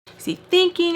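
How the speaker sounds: high, loud indignant outburst; worked-up mock outrage; upset. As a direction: imitate someone fuming in disbelief. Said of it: "she starts getting upset with me".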